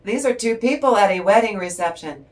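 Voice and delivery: normal talking voice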